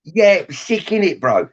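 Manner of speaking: mocking a South London kid, pitch jumping up then dropping; lightly mocked South London street accent; South London kid slang. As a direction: sneer like a Croydon teenager. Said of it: "A South London kid would probably go".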